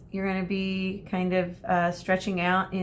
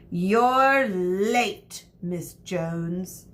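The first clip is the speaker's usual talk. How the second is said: louder, cold teacher's rebuke; glaring teacher; 4 dB louder, much slower than usual. A narrator's reading. Teacher rebuking Medusa for arriving late. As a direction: scold her with a stare. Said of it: "Miss Medea glared".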